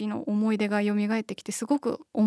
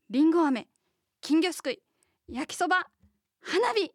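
higher-pitched dramatic preview narration; next episode preview; 5 semitones higher than usual. Pretend. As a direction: announce it like a TV next-episode teaser. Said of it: "次回予告風に締めさせていただきたいと思います".

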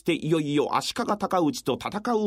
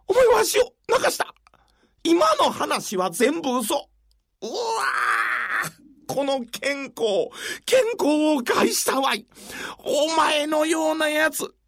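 outraged wail; 7 semitones higher, slower than usual. Acted. Tricked official wails in anger.

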